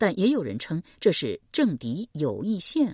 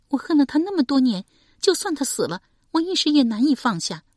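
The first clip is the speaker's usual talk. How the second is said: put-on higher, grieving young woman's voice; young woman recounting her mother's murder, higher voice; young woman Shi Pei; 10 semitones higher, 5 dB louder, faster than usual. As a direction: tell it as a wounded daughter.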